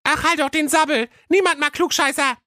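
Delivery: high squeaky snapping chorus; high cartoon sisters telling her off. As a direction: shut her up, high and cheeky. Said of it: "riefen ihre elf Schwestern im Chor".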